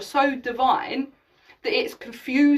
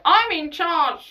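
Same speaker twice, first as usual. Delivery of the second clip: mock bossy; 6 semitones higher, 5 dB louder, slower than usual. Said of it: "They're still trying to be like".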